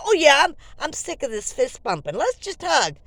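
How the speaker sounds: loud, eager yes; excited. Retold or imitated. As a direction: gush with enthusiasm. Excited reply to hug.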